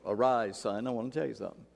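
soft, low tender voice; gentle God. Acted. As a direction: coax him gently like a kind father. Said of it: "I don't know what tone God used".

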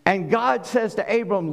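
normal talking voice

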